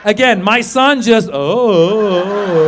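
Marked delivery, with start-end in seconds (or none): 1.3-2.6 long vacant oh; oblivious boy zoning out; spaced out. Retold or imitated. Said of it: "boys get a little spaced out".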